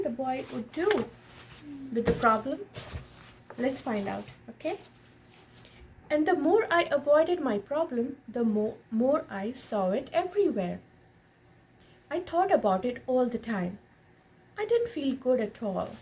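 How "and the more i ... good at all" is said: gentle storybook read-aloud; lightly animated picture-book narration; reading to a child. A narrator's reading. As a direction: read it like a bedtime story to your son. Reads picture book to son.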